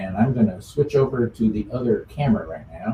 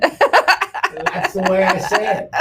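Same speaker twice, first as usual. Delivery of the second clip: laughing defensively; 9 semitones higher, 3 dB louder, slower than usual. Real, not acted. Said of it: "I'm being teased".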